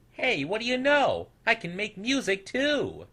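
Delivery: high, thrilled discovery; excited little snoodle voice; delighted little snoodle. A narrator's reading. Snoodle finds kazoo.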